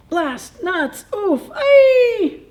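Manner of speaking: high squirrel yelps building to a shout; squeaky critters fumbling the catch; frustrated squirrels. A narrator's reading. Squirrels miss catching her.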